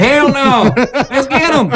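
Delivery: laughing cheer. Retold or imitated. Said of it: "I was like".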